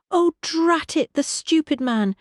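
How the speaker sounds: a lady annoyed at a nuisance; irritated. A narrator's reading. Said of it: "she said in irritation".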